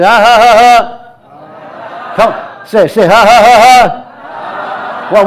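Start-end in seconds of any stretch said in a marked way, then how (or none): 0.0-2.1 punchy, deliberate fake laugh; laughing yoga. Shown as a demonstration. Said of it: "laughing yoga or something like that". 3.1-5.1 punchy, deliberate fake laugh; laughing yoga. Shown as a demonstration. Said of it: "laughing yoga or something like that".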